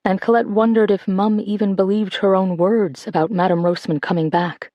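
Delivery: ordinary delivery, nothing marked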